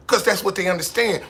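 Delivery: normal talking voice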